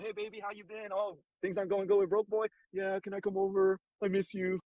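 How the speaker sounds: imitating sweet-talking girl. Girls calling the guy after a breakup, imitated coaxing.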